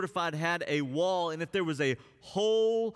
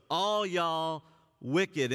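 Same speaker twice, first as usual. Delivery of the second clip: slow, drawn-out Southern "All y'all wicked."; southern twang; 3 semitones higher, much slower than usual. Shown as a demonstration. Preacher voicing the prophet to his church in a Southern twang. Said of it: "If he was to give it a little southern twang".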